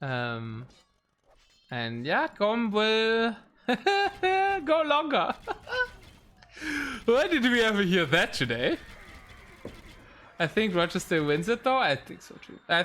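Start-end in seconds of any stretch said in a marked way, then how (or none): 2.4-8.8 laughing. Real, not acted. Laughing at a drinking-game word.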